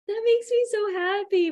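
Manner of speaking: put-on overjoyed mom melting at her kids; delighted squeal. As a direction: let the joy burst out high.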